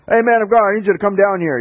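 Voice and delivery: high, loud mock hail; calling out. Second captain hails the prophet on the hill, loud and high. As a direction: call up the hill to him.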